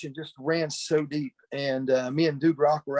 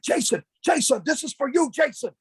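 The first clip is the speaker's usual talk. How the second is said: much higher mock announcer voice calling Jason; high, grating mock host voice; annoying loud host; 10 semitones higher than usual. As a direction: ham up an annoying signing host. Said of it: "he talks really loud. His voice is kind of annoying".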